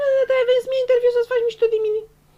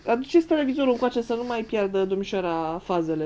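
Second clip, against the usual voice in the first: ordinary talk, nothing marked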